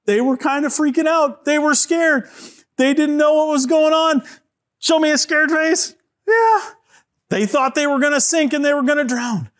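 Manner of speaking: high-pitched, frightened storytelling; mock panic. A narrator's reading. Telling children the disciples thought they'd drown, mock panic. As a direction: tell it as if freaking out.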